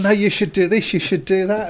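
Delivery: mock backseat manager barking orders; bossy fan. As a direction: boss the manager around like a know-it-all fan. Fans telling the manager what to do.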